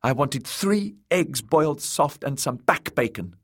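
put-on high childish grumble; complaining boy. A narrator's reading. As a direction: list the demands in a high, petulant voice. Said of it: "he complained when he saw her".

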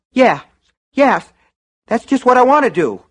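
loud, high-pitched eagerness; fervent agreement. A narrator's reading. Customer leaping at the shopkeeper's suggestion, fervent. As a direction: seize on the idea, pitch soaring.

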